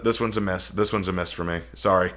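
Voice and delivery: usual speaking voice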